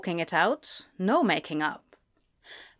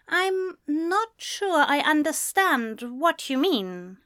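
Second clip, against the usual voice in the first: careful, higher-pitched character voice; measured, wary reply in a high voice; said slowly; 7 semitones higher than usual. A narrator's reading. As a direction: pick the words cautiously. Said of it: "he said slowly".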